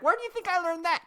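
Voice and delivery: high, loud indignant question; re-enacted gotcha, voice way up; triumphant retort. Triumphant comeback to aunt.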